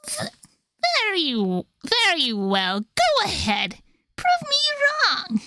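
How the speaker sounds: taunting villain. Acted.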